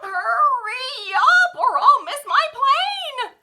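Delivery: high, loud, slow and pleading; frantic woman. A narrator's reading. Woman in taxi begging driver, high frantic plea. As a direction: urge the driver on, desperate. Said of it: "she told the taxi driver".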